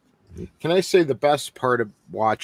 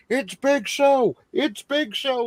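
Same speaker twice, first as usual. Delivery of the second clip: high, slow shrieking announcer call; J R impression; 12 semitones higher, slower than usual. Mocking J R calling Big Show's entrance, high and shrill. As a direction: scream it like an excited commentator. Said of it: "here's J R".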